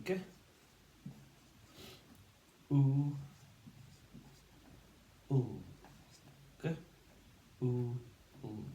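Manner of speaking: G, oo, oo as isolated phonics sounds, the last oo a dark L; sounded out. Shown as a demonstration. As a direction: hold each sound of G, oo, oo apart with pauses. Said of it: "And we say".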